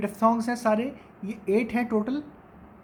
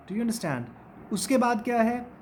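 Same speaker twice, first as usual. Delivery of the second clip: usual speaking voice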